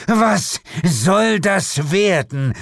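high, slow, indignant demon mother; angry demand. Acted. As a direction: draw out each word menacingly. Monster confronts the detective.

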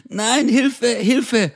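high-pitched cry for help; mock panic. Captive grabbed by an attacker, mock panicked cry for help. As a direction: call for help in acted terror.

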